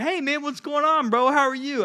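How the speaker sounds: high, welcoming smiling greeting; upbeat, high-pitched friendly hello. Shown as a demonstration. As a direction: greet a buddy with a big smile in the voice. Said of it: "what do you think is the difference?".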